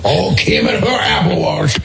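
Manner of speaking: slow, hammed-up old Southerner; old Louisiana man. Co-host plays along, ribbing her watch habit as a slow old Louisiana man. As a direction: draw it out like an old Louisiana geezer. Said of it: "ninety-year-old guy from Louisiana".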